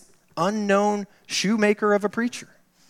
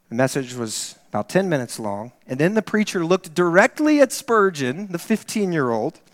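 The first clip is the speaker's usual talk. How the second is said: ordinary talk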